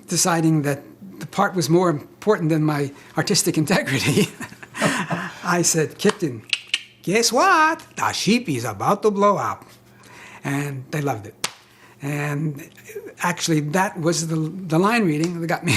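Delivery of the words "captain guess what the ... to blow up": put-on funny Russian accent. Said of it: "could you make it funny?".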